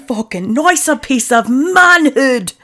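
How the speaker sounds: grand, high showman narrator; over-the-top celebrity narrator; Robin Leach narrating. Retold or imitated. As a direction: announce it like a lavish TV host. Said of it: "I want Robin Leach to narrate".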